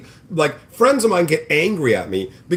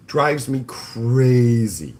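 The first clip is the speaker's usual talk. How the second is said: slow, stretched exasperated groan; drawn-out exasperation; 4 semitones lower, much slower than usual. Real, not acted. Streamer fed up with freeloaders, slow exasperated drawl. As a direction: drag it out, fed up.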